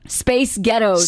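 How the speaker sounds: slow American pronunciation; careful American accent demo. Shown as a demonstration. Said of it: "like an American accent".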